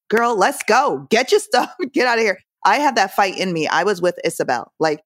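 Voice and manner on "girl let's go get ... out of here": higher-pitched, exasperated hurry-up; mock-exasperated, chivvying a friend along; impatient urging. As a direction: urge her out, half laughing at the end. Acting out her impatient reaction.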